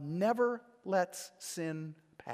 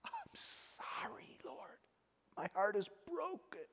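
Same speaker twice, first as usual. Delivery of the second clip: mock repentant whisper; 7 semitones higher, 8 dB quieter than usual. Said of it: "what does Cain say then".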